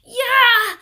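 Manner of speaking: very high, loud cheer; triumphant yeah. A narrator's reading. Paul grabs the pigtail and whoops, very high and loud.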